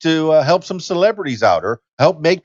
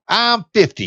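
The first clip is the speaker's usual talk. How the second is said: higher, put-on SNL sketch voice; Molly Shannon character; 6 semitones higher than usual. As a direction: play the proud fifty-year-old sketch lady. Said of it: "likened myself to the character that Molly Shannon did on SNL".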